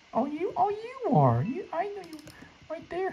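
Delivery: sing-song high taunt at the hider; falsetto taunt. Pretend.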